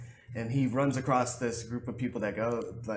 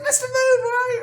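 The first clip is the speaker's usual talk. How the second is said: very high, louder laughing call; mock calling out; 12 dB louder, faster than usual. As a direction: call out teasingly while laughing. Captor calling out, retold.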